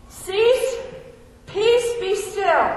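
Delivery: loud, high command. Acted. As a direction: order the storm to calm. Jesus commanding the storm.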